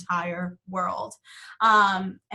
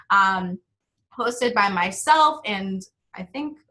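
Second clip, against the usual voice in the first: ordinary talk, nothing marked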